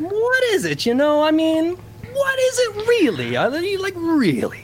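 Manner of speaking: high mock-dramatic voice. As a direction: ham up the big question, high and sing-song. Mock deep philosophical questioning.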